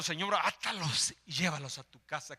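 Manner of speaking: normal talking voice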